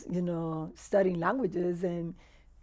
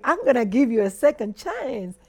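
louder, higher, punched-out retold resolve; lively, raised-voice quote of her own decision; emphatic self-quote; 5 semitones higher, 10 dB louder than usual. Realtor retelling her decision to give the agent another try, emphatic. Retells what she told herself.

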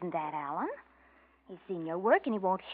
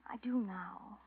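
whisper; 7 dB quieter, much slower than usual. Acted. Pleading with ex-lover Alan.

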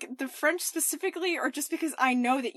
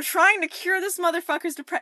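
ordinary talk

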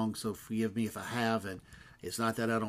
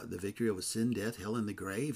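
usual speaking voice

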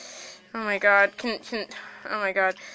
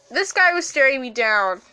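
normal talking voice

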